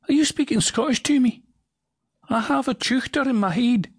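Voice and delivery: very high, startled little voice; squeaky surprised voice. Acted. A startled voice asks if it's Scottish, squeaking. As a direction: squeak out the question in alarm.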